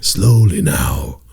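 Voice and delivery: very slow, low, drawn-out; slow lulling. Shown as a demonstration. Fan demonstrating the guru's slowly now. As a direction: stretch it out like a sleep guide. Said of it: "you heard how I did that".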